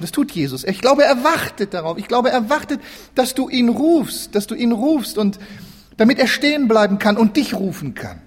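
usual speaking voice

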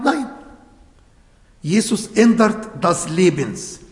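usual speaking voice

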